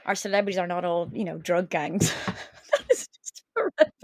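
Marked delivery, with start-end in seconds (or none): 2.7-3.9 high exaggerated horror; mock horror. Real, not acted. Friend reacting to gang feuds listed as celebrities, high mock horror. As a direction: recoil in fake shock.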